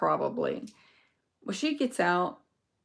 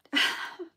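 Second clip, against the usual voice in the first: breathy laugh; 8 semitones higher than usual. Real, not acted. Laughs at the liar.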